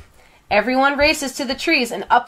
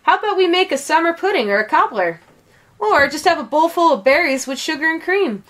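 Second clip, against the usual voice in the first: high, eager calling out; calling across; 6 semitones higher than usual. A narrator's reading. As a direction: pitch a happy idea to someone across the bushes. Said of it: "calls across the brambles".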